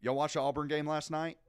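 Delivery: high, loud Southern dad on the phone; dad impression. Son imitating dad calling about the game, dad impression. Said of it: "like, your dad gives you a call".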